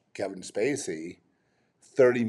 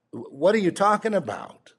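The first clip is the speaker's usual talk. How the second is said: high and louder, exasperated disbelief; incredulous outburst; 10 semitones higher, 6 dB louder than usual. Real, not acted. Ranting to viewers about Hollywood, high loud incredulous outburst. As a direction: throw up your hands in outrage.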